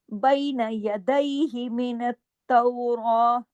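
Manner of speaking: बैना recited with a rounded diphthong; ya leen diphthong. Shown as a demonstration. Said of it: "ये या लीन है".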